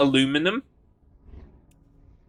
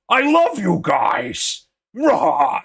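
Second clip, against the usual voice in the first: higher, louder and slow big furry monster; big hairy Muppet; 7 semitones higher, 8 dB louder, much slower than usual. Retold or imitated. Retelling Muppet Treasure Island, the hairy one thanks the pirates in a booming voice. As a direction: boom it out like a lovable hairy beast. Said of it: "the big hairy one comes in".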